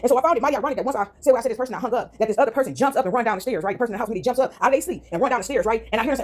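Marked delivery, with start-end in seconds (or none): none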